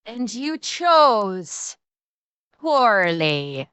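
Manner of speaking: very slow, drawn-out doom line; movie line. Pretend. Quoting a film line to the loser, very slow. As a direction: deliver it like an ancient knight. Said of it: "you made your choice".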